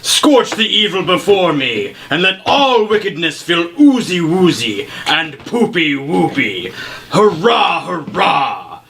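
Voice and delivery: grand mock battle cry, slow start; heroic incantation. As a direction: declaim it like a silly holy spell. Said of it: "I'm going to say".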